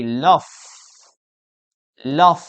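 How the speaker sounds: LOVE ending in a hissed F; wrong pronunciation. Shown as a demonstration. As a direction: say LOVE as "luff", with an F. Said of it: "I used to say love".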